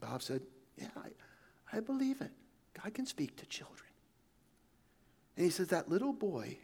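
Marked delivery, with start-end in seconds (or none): 0.8-3.9 hushed whisper. Retold or imitated. Missionary answers boy softly.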